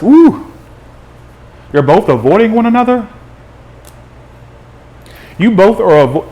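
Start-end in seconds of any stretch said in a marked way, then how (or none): 0.0-0.5 a sudden high, loud woo; excited whoop. Real, not acted. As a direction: let out a thrilled whoop at the cards. Excited whoop at a card spread.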